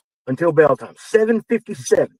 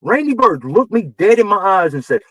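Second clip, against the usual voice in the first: usual speaking voice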